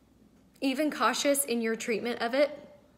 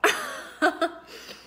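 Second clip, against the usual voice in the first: relieved laugh; 7 semitones higher, 3 dB louder than usual. Real, not acted. Laughs off the emotional moment.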